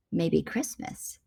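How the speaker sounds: soft, slow, low musing; hushed, tentative inner thought; thinking to himself. A narrator's reading. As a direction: let the idea dawn quietly. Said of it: "he thought".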